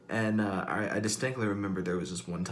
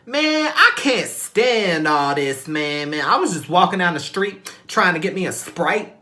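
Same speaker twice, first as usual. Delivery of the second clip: much higher and louder sassy woman voice; woman retelling a crash to her friend; hammed-up sassy street woman; 11 semitones higher, 11 dB louder than usual. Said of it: "women that would be like".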